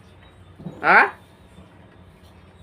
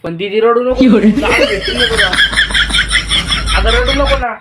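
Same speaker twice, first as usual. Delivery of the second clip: teasing laugh; 5 semitones higher, 4 dB louder than usual. Pretend. Brother teasing crying sister.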